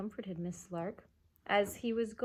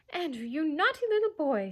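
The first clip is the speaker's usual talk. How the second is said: higher, louder fussy lady scolding; Miss Lark voice; 7 semitones higher, 6 dB louder than usual. A narrator's reading. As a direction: scold like a prim old lady. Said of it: "her voice ... was much more like Miss Lark's".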